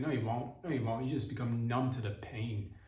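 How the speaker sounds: ordinary talk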